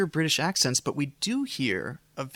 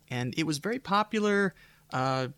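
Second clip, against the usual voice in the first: normal talking voice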